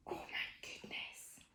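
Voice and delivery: shocked whispered exclaim. Acted. Reading to a child, horrified whisper. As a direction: whisper it in mock horror.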